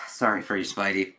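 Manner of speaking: ordinary talk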